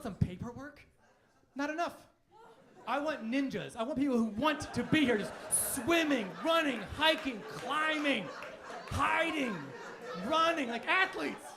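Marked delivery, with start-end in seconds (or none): 2.9-11.4 louder, building list yelled with laughter; rally the crowd with each word; rousing shout. Real, not acted. Comic ranting to the crowd, louder and building.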